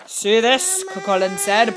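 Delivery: loud boasting giant; boastful giant. A narrator's reading. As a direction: show off like a bully giant.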